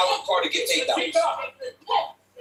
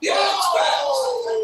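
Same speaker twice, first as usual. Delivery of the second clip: excited shout; 13 semitones higher, much slower than usual. Real, not acted. Commentator reacts to fast strike.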